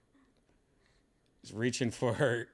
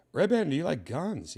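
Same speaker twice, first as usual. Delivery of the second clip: playful question to pet; 8 semitones higher, 6 dB louder than usual. Pretend. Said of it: "asks the cat in a voice".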